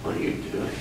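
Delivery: soft breathy question; hushed. Shown as a demonstration. Teacher prompting student, hushed question. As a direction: murmur it to draw a reaction. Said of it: "what's your response to me".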